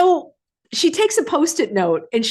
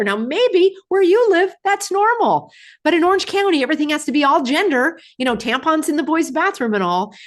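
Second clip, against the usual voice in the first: mock sarcastic tone; 8 semitones higher than usual. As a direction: poke fun at what passes for normal. Mocking people elsewhere.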